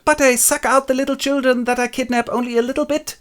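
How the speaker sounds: high, put-on pleading voice; vampire Lucy. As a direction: plead your case in a tiny high voice. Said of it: "Lucy acting as a vampire".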